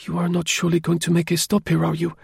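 anxious plea to keep driving; apprehensive urging. A narrator's reading. Woman urging the driver not to stop, apprehensive. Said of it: "she urged in apprehension".

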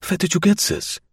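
high, startled question; astonished exclamation. Acted. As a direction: demand it in amazement, German accent. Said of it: "he exclaimed".

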